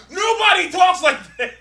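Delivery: high, loud laughing protest; incredulous outburst. Real, not acted. Mocking awful comic book dialogue, incredulous outburst. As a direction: burst out in amused disbelief.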